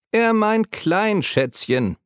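put-on high, slow voice of a small old woman; drawn-out teasing old lady's voice; schrille Frauenstimme. A narrator's reading. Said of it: "ertönte eine schrille Frauenstimme". (hohe, langsame Stimme einer kleinen alten Frau)

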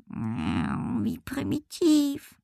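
high, soft, very drawn-out meowing voice; disdainful cat. A narrator's reading. Cat scorns the jumping request, meowing disdain. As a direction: sneer slowly like a haughty cat. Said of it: "Frau von Schmidt verzog das Gesicht".

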